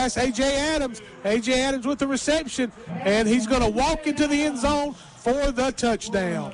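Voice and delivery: rising, excited play-by-play call; touchdown call. Real, not acted. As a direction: call the score as it happens. Touchdown call on live broadcast.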